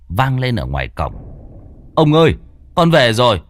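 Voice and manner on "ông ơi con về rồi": calling out. A narrator's reading. Servant calling from gate.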